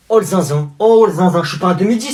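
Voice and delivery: indignant, yelling back; insulted over his age, yelling at the cousin; offended shout. Acted.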